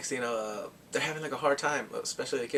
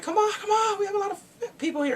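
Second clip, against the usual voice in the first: high-pitched calling to be let through; imitated urgent plea; 3 dB louder than usual. Acting out crowd pleading to board.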